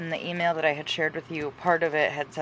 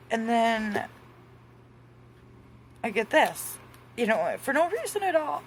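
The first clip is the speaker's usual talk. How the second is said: higher-pitched, holding back real tears; trying not to cry; 6 semitones higher than usual. Real, not acted. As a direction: keep talking while fighting happy tears. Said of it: "I'm gonna really try not to cry".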